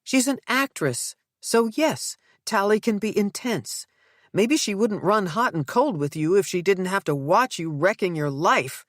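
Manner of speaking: high, heated scolding wife; angry wife. Acted. Nell keeps scolding Zeke.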